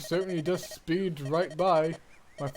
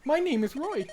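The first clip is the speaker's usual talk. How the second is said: put-on higher, polite little boy; young boy voice; 8 semitones higher than usual. Roy greets his father's old friend, boyish and high. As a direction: play the shy, eager young son.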